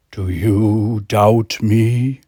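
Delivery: drawn out, looming and slow; very slow god voice. Acted. God menacing McCoy, very slow and looming. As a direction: stretch every word like a looming deity.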